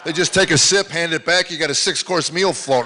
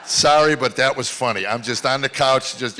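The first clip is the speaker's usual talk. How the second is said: normal talking voice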